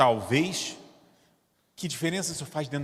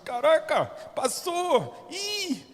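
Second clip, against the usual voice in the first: mock surprise; 11 semitones higher, much slower than usual.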